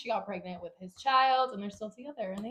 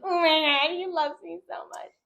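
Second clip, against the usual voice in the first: higher, louder lovestruck gush; mock swoon; 9 semitones higher, 7 dB louder than usual. Mocking her teen self who thought he loved her, swooning. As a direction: gush like a naive teen in love.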